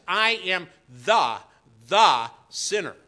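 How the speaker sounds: "the sinner" with THE hit loud and slow; stress on THE, loud. Retold or imitated. Preacher voicing the tax collector's confession, loud and emphatic. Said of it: "This says".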